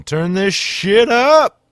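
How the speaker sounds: high, very loud, drawn-out yell; hyped shout. Pretend. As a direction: holler up to the sound booth.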